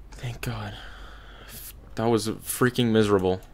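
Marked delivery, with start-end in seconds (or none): 0.1-0.7 whispered relief; whispering. Real, not acted. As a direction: breathe it out under the breath. Relief after escaping.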